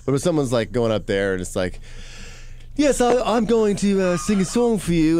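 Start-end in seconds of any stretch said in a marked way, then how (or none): 2.8-5.2 high, drawn-out affected voice; slow, higher fake performer introducing a song; put-on singer's voice. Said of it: "that's not your voice".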